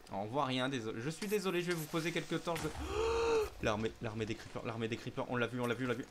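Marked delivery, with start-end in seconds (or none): 2.7-3.6 startled gasp. Real, not acted. Creeper army appears.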